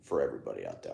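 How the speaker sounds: low, soft near-whisper; soft ASMR voice. Real, not acted. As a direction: hush it down for the mic. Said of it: "I'll try to do more A S M R".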